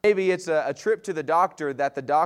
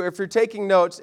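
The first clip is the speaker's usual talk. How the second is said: normal talking voice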